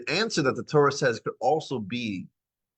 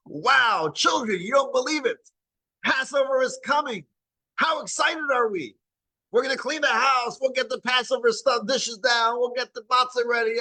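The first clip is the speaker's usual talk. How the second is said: much higher, shouting with delight; excited parent; 13 semitones higher than usual. Shown as a demonstration. Parent thrilled telling children Passover is coming, excited shout. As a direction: announce Passover like thrilling news to the kids. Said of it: "don't emphasize how difficult it is".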